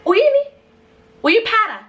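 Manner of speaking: very high, loud incredulous questions; high-pitched mock outrage; shocked mother. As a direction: imitate an appalled mother's shrill disbelief. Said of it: "she was more shocked".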